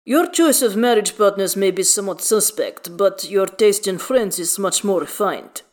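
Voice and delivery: weighty character voice, emphatic and measured; Halsin. A narrator's reading. Halsin messages his friends about new guests, measured character voice. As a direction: give each phrase firm weight in another timbre.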